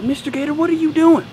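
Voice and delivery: high, loud mock demand; scolding teacher. Teacher catches pupil at the pencil sharpener, mock scolding.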